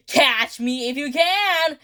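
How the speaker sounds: high, slow villain taunt; singsong taunt. Acted. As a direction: stretch out the dare, high-pitched. Scout's chase taunt.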